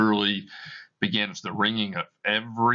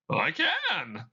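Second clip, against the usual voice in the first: ghostly Marley voice; 11 semitones higher than usual. Acted. Dead partner answering Scrooge, eerie high ghost voice. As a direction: moan it out like a spirit.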